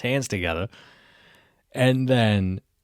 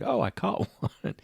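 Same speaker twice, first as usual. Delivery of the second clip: high, soft, laughing brag; mock proud; 9 semitones higher, 6 dB quieter than usual. Quiet shooting the water, laughing smugly. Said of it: "is like".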